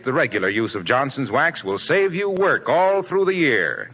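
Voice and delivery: ordinary delivery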